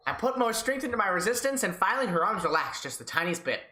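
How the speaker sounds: ordinary talk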